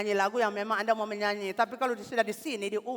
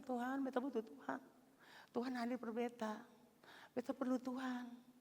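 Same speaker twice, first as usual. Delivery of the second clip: soft pleading prayer; 4 semitones higher, 12 dB quieter than usual. Shown as a demonstration. Pastor showing the congregation how to pray, soft and pleading. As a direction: breathe the prayer gently. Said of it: "kalau punya masalah bilang begitu".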